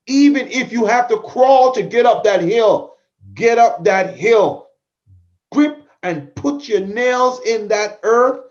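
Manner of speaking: fired-up pep talk, loud and slow at the end; rallying push. Real, not acted. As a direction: drive it home like a coach at the last stretch.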